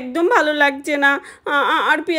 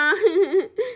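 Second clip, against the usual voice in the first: short high giggle; a quick girlish giggle; 4 semitones higher than usual. Acted. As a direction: let out a little giggle. Laugh alone on line.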